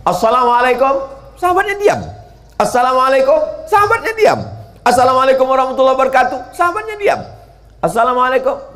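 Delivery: salam yang dipanggil keras dan tinggi; repeated call at the door. Retold or imitated. Prophet greeting at door.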